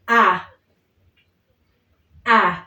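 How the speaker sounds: A said clear and open; vowel A. Shown as a demonstration. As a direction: model the vowel A for repeating. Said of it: "So we can start".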